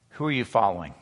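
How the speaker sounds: ordinary talk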